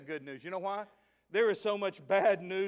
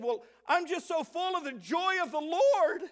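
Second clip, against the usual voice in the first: joyful churchwoman; 10 semitones higher, 6 dB louder than usual. Retold or imitated. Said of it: "She said".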